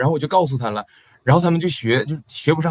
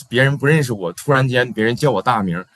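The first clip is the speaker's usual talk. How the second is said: ordinary talk, nothing marked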